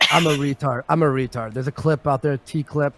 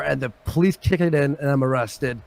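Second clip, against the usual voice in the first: normal talking voice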